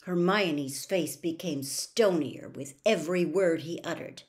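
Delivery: ordinary delivery, nothing marked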